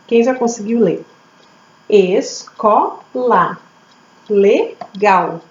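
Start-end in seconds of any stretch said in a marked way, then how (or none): 1.9-3.6 "Escola" slowly split as Es... co... la; teacher's slow reading of "Escola"; syllable by syllable. Shown as a demonstration. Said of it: "Três vezes". 4.3-5.4 "Legal" split as Le... gal; syllable by syllable. Shown as a demonstration. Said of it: "Quantas vezes eu abro a boca".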